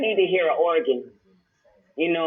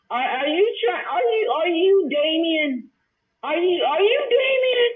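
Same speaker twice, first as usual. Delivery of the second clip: incredulous high rant; 8 semitones higher than usual. Real, not acted. Ranting at Carrie Ma on stream.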